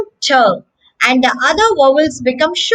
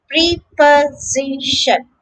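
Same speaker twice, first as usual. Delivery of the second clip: slow "preposition", pre-po-zi-shun; drawn-out, separated "preposition"; syllable by syllable; slower than usual. Shown as a demonstration. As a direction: sound out each syllable of "preposition", s as z. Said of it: "notice that the S is pronounced".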